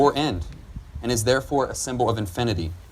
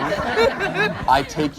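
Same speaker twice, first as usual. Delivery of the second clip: laughing; 12 semitones higher, 4 dB louder than usual. Real, not acted. Officiant laughs through vow.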